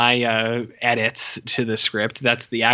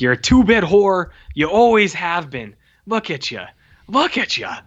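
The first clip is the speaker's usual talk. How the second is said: higher, punched mock yelling; retold screaming cop; 6 semitones higher than usual. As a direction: berate her as the angry cop, imitated. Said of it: "the cop is just fucking screaming at her".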